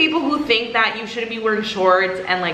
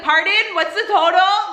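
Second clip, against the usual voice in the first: higher, pointedly raised voice, acted out; deliberately loud; 8 semitones higher than usual. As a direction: imitate asking extra clearly on purpose. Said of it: "I just say it so loud on purpose".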